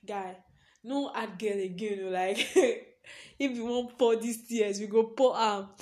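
retold self pep talk.

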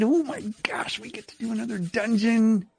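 high breathy excitement; excited gushing. Retold or imitated. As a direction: gush like a thrilled gamer. Imitating excited players.

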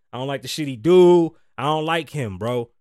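exasperated loud complaint; loud rant. Real, not acted. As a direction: belt it out in disgust. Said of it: "building rant".

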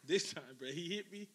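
ordinary delivery

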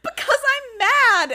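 high, loud mock outburst; mad, tearful retort. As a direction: shout it back as an imitation of an upset girl. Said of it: "Why are you crying?".

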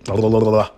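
rapid rolling nonsense babble; tongue-tied babble. Real, not acted. Podcaster tripping over character names, frustrated babbling. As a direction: give up on the word and babble.

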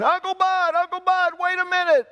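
high call from far behind. Acted. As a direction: call after him running down the hall. Doctor calls from down the hall.

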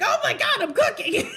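high, loud alarmed cry; hammed-up sudden horror; acted panic. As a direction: shriek it as if dinner is burning. Acting out sudden panicked remembering.

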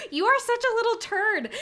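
high, loud teasing insult; playful loud scolding; exasperated scold. Retold or imitated. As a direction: scold him like a naughty kid. Scolding boyfriend.

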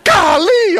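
high, loud burst of exasperation; mock exasperated yell. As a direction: explode in hammed-up frustration. Mimics an exasperated outburst at home.

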